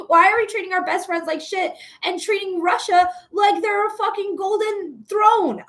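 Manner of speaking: normal talking voice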